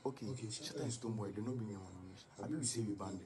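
low mocking impression of the organiser. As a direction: drop deep and quiet to mock them. Voicing someone in low mock voice.

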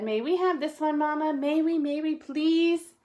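high, eager cub voice; small cub's insistent, high-pitched plea; pleading little sister. A narrator's reading. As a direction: beg Mama for it in a high voice. Sister begs Mama for the puppy.

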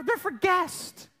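high, drawn-out mock-outraged mother; scolding mom. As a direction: stretch it out like an appalled mom. Mother explaining the towel rule.

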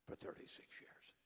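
hushed whisper; soft reverent whisper; whispered awe. Real, not acted. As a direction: breathe it out in quiet wonder. Quiet awe at brother's service.

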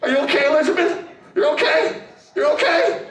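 squeaky high, chuckling puppet. Pretend. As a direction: voice a tiny cheerful creature.

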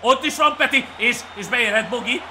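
high, loud play-by-play; excited commentary. Real, not acted. Gamer calling Peti and Bogi's run, excited shouting. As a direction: call the runners with rising excitement.

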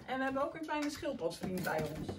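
ordinary delivery, nothing marked

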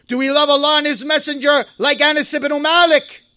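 usual speaking voice

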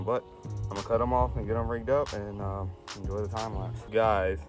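ordinary talk

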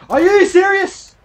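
high, slow disbelieving shout; shocked shout. Real, not acted. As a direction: stretch it out in disbelief. Shocked game reaction.

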